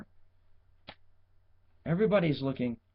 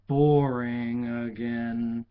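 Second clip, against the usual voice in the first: dragged-out boring; 4 semitones lower, 4 dB louder, much slower than usual. A narrator's reading. Story narration.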